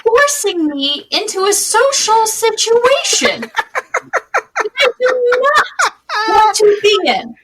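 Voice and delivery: high, loud mock-exasperated protest; hammed-up wail of social dread, half laughing; comic dismay. Acted exasperated reaction to invitation.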